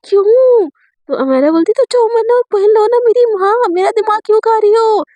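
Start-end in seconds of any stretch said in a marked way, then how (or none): 0.1-0.7 protesting why. Retold or imitated. Refusing the outfit suggestion, demanding why. 1.8-5.0 high-pitched, fed-up mock plea; exasperated pleading. Acted quarrel over choosing an outfit.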